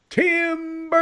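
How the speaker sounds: high, loud call of timber; mock timber call. Man mocking the ladder's fall, calling timber high. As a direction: sing out timber like a lumberjack.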